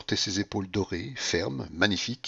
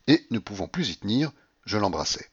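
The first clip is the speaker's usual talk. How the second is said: usual speaking voice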